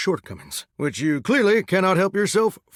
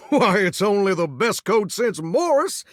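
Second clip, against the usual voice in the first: ordinary talk, nothing marked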